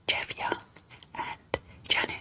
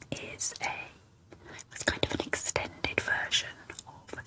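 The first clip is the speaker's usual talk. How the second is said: asmr whisper. Real, not acted. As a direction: whisper every word close and gentle. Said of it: "in an A S M R whisper".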